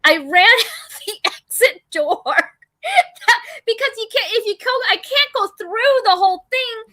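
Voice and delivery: half laugh, half cry; laughing distress. Real, not acted. Telling a friend the shark story, laughing and near tears. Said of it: "I was traumatized".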